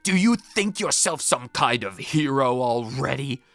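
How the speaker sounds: growling strict headmaster berating a pupil; stern headmaster. Acted. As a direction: bark at the boy like a tyrant schoolmaster. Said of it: "I'm not going to do that voice".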